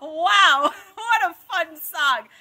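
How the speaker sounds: loud, high and thrilled; excited. Real, not acted. Host cheering the song for young viewers, excited.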